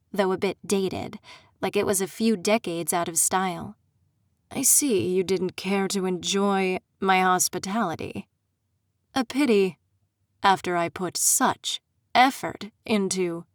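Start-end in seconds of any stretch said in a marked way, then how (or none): 4.5-13.4 a smug aristocrat savoring his mockery; Aldrin, smug fae prince. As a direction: purr it out like a gloating prince. Aldrin's villain voice, held.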